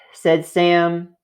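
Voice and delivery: usual speaking voice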